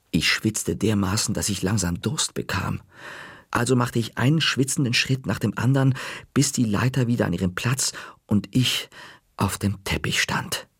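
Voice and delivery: usual speaking voice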